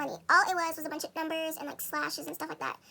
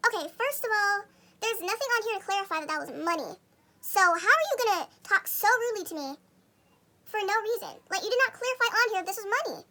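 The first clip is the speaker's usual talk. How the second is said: louder, higher retold telling-off; reenacting an offended comeback; indignant talking back; 4 semitones higher, 5 dB louder than usual. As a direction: argue back heatedly, pushing the volume up. Retold angry talking back to lady.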